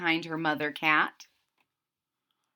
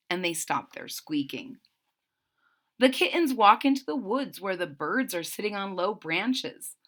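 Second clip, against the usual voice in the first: ordinary delivery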